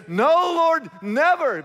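loud, high insistence; a bold fisherman swearing loyalty; Peter's bold protest. Retold or imitated. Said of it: "Peter said".

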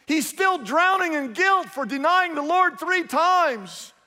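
rising to the sermon's peak; preaching at full force. Acted. Preaching climax.

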